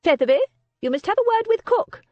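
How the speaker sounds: lightly voiced shrewd old lady, amused; a hint of a wry old aristocrat teasing; lightly voiced old dowager, wry and fond; old Lady Beatrice. Old lady frowns at the muffins.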